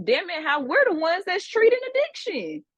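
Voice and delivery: fired-up exasperation; heated rise. Real, not acted. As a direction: pitch jumps high as she vents. Heated outburst in rant.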